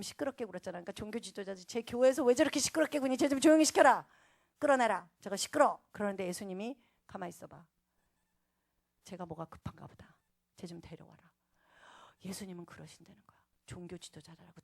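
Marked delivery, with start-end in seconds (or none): 1.7-5.8 acted irritated order, higher and louder; mock scolding. Religious leaders ordering the noisy man out, scolding. 7.1-11.2 acted low, soft instruction; calm, hushed authority. Jesus asking for the blind man to be brought, hushed and calm. Jesus calmly halts the scolders.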